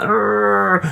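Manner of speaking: a hurting friend moaning from below; pained groan. Retold or imitated. Fallen friend groans back from the car roof, imitated high groan.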